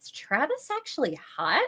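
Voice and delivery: high, lightly dreamy imitated question; mock-smitten wondering; a little dreamy. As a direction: play it as a girl musing over a crush. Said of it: "a little dreamily and says".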